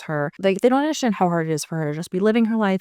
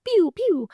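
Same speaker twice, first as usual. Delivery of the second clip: high, playful "pew" sound effects; imitated magic zaps; 13 semitones higher than usual. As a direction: squeak out little laser-like zaps. Imitating little magic bursts.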